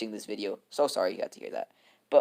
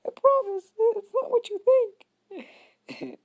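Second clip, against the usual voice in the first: much higher squeaky pleading promise; slower than usual. Acted. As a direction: squeak it out like a scared kid. Desperate denial, voice jumps up.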